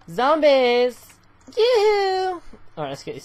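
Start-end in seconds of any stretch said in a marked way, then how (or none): 0.1-1.1 loud call. Acted. Calling out to zombies. 1.6-2.4 high sing-song yoo-hoo; high yoo-hoo call. Pretend. Calling the zombies sing-song.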